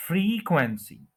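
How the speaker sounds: Frequency as FREE-kwen-si, long high FREE; frequency with stretched FREE, falling after; stress on first syllable. Shown as a demonstration. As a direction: give the first syllable of frequency its full time. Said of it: "first जो syllable है उसके ऊपर stress".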